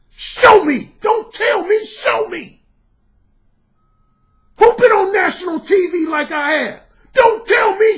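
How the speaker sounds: loud, high, furious shouting; furious rant. Real, not acted. Dating coach yelling at doubters to prove it, furious shout. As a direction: yell it at every doubter.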